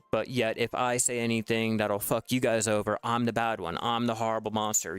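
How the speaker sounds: usual speaking voice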